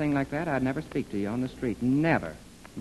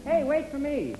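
high call, pleading; 11 semitones higher, slower than usual. Acted. Clarence left behind on the sidewalk, high plaintive call. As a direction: cry out as they walk off.

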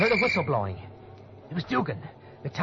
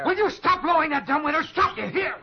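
loud, high-pitched outburst; frantic, snapping shout; 13 semitones higher, 6 dB louder than usual. Acted. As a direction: yell at him in a panic to stop. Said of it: "I'm sorry I, I shouted".

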